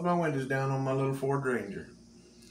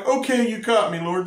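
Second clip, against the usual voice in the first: higher, louder sheepish surrender; caught, giving up; 7 semitones higher, 6 dB louder than usual. Retold or imitated. Preacher voicing people who finally stop running from God, playful surrender. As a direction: throw up your hands, playful give-up. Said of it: "And then we're finally".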